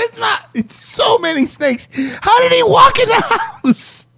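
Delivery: high, strained disbelieving squeal; mock astonished caller. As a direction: squeal it like you can't believe it.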